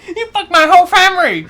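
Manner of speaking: high, loud mock Vietnamese accent. Co-host accusing partner in jest, loud Vietnamese accent. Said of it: "I will do a Vietnamese accent".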